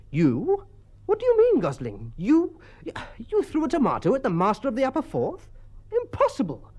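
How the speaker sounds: very high, astonished schoolmaster; incredulous outburst. A narrator's reading. Master learning the porter threw the tomato, high-pitched and incredulous. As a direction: splutter in shocked disbelief, pitch leaping up.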